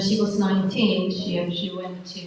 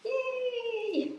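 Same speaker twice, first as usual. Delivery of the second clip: excited cheer; 7 dB quieter than usual. Real, not acted. Cheering at arrival.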